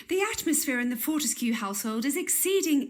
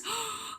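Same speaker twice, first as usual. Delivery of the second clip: gasp; 9 semitones higher, 8 dB quieter than usual. Acted. Realizes she wronged parrot.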